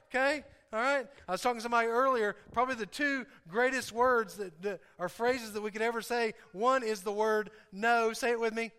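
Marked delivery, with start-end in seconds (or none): none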